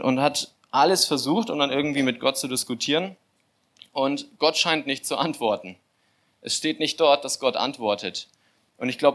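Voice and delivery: ordinary talk, nothing marked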